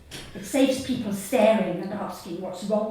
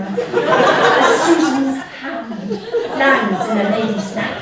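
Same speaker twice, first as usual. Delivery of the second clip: slow comic build; 4 semitones higher, 7 dB louder than usual. Acted. Punchline build about flying hand.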